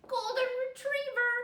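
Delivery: high, loud proud answer; child proudly answering the teacher; eager child answer. A narrator's reading. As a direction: pipe up proudly.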